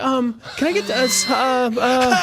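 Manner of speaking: high, loud stammering panic; the mother panicking as her son throws up, stammering; flustered panic. Retold or imitated. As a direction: stumble over words in a rising panic. Mother flustered, child vomiting.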